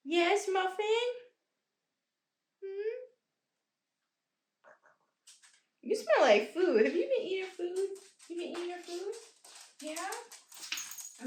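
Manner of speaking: doting high-pitched voice; pet talk. Real, not acted. Questions her pet affectionately.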